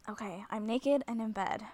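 normal talking voice

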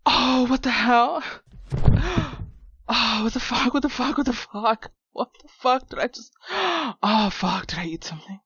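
loud, gasping disbelief; panicked shock. Acted. Man discovering his shrunken friend, panicked. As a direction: freak out between sharp gasps.

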